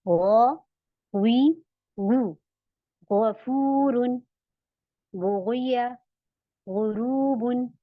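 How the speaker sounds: गौ, गुई, गु thick and throaty; slow, measured गफूरुन and गुरूबुन with gh from the back; heavy ghain drill. Shown as a demonstration. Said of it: "ऐसे".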